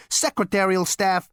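ordinary delivery, nothing marked

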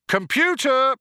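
loud high shout. A narrator's reading. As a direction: bark the call out loud. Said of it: "shouted Zaphod".

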